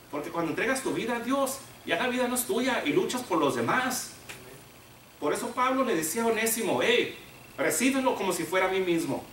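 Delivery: loud, high preaching at full force; fiery preaching. Acted. Preacher rousing the congregation about giving life to God, loud fervent preaching.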